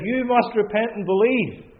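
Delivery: ringing preacher's command; commanding call. Retold or imitated. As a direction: proclaim it as God's order. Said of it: "And he says".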